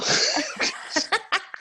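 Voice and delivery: airy high-pitched laughter; high breathy laugh. Real, not acted. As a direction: laugh at your own joke. Laughing at own impression.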